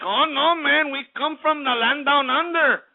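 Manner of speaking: high, slow mock voice; high, slow mocking imitation. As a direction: parody their excuse. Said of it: "people wanna sit over here and think".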